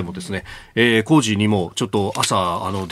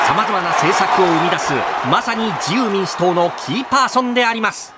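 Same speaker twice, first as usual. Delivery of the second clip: sports commentator; 8 semitones higher than usual. Acted. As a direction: hype play-by-play voice.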